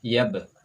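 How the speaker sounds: crisp teacher demo of Yab; Arabic Yab, clear bounce on b. Shown as a demonstration. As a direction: say Yab with the b released. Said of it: "coba lafalkan".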